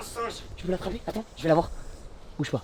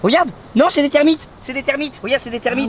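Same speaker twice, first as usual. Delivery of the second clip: excited; 6 semitones higher, 4 dB louder than usual. Real, not acted. Discovering termites.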